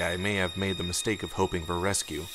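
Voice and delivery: ordinary delivery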